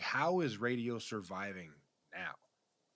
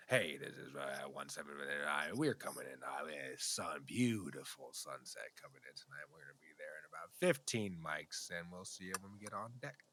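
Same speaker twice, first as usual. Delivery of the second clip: pilot radio voice; 6 semitones lower, 6 dB quieter than usual. Retold or imitated. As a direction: talk like a pilot on the headset. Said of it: "definitely a pilot voice right there".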